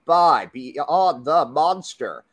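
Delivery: slow, high podcast intro voice; announcer. Acted. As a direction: announce the show.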